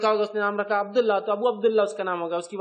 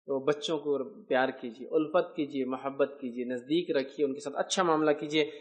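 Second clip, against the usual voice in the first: gentle plea; 6 semitones lower, 4 dB quieter than usual. Real, not acted. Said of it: "gentle exhortation to love children". (نرمی سے التجا)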